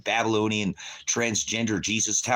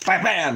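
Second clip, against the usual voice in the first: imitated gunshots; 7 semitones higher, 5 dB louder than usual.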